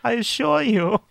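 high, smug Bond villain drawl; slow theatrical villain menace. Acted. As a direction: purr it like a gloating Bond villain. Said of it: "Bond villain speech".

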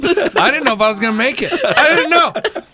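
high, loud and laughing. Real, not acted. Laughing about pandemic fear.

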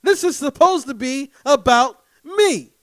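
high, loud indignant rant; exasperated outburst. Retold or imitated. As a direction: blow up like the birthday's been stolen. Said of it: "That's how I feel".

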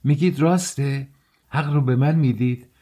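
high-pitched, thrilled questioning; excited cry. A narrator's reading. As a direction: burst out in eager delight. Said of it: "به منتهای هیجان رسیده بود فریاد زد". (فریاد پرهیجان)

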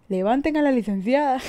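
loud, laughing, high call; laughing mock call. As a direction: laugh while calling it out. Imagined crowd lifting drunk licenciada. (gritando entre risas a la gente que la levante)

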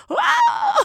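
very high shouted wow; mock astonished; shocked wow. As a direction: gasp it out in shock. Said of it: "the young girl again is just like".

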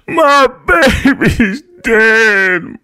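very high, loud, slow crying moan. A narrator's reading. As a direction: moan it through sobs. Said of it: "my mother moaned".

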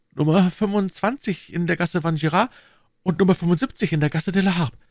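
high-pitched voice for the meek shopkeeper; Bonacieux. A narrator's reading. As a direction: tell it high, as the humble husband. (hoher, unterwürfiger Krämer)

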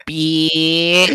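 high, drawn-out punchline; mock sentence ending. Joking how girls end sentences, high drawn-out word. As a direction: stretch it out high.

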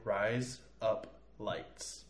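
"Rise up lights" very slowly, a gap after each word; slow, separated words. Shown as a demonstration. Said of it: "if you say it in normal speed".

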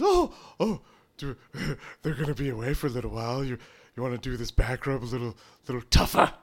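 flirt breathlessly fast; fast flirty lightly voiced; flirty [name].

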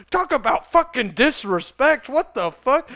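high mock-outraged exclamation; indignant ranter. Imitating the video ranter fuming over disrespect, indignant. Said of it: "just like".

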